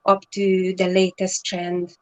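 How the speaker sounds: ordinary talk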